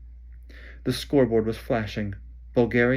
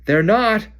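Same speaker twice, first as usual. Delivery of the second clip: higher, loud defiant roar; 11 semitones higher, 9 dB louder than usual. Acted. Ron roaring back at Hermione, defiant. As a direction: bellow it back. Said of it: "roared Ron".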